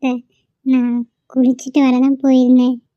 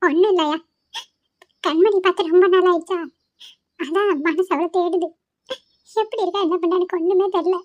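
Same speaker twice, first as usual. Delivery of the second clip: plaintive, higher and subdued; tearful; 4 semitones higher, 4 dB quieter than usual. Acted. Grandmother telling her son she misses Kanmani, tearful. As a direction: ache with longing, close to crying. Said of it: "இப்படி உட்கார்ந்து அழுதுட்டு இருக்க".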